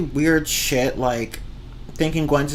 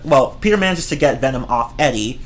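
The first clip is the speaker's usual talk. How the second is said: ordinary talk, nothing marked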